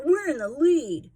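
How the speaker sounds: high, loud crowing cry; gleeful shout. Acted. Sleater crowing about his lead, loud and high. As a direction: crow it out like you just scored. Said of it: "shouted Sleater".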